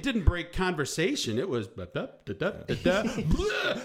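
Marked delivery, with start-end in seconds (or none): 1.7-3.8 comic sound effect breaking up chatter; imitated chatter and splat. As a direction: babble syllables, then a high drawn-out bleh. Mimics chatter interrupted by splat.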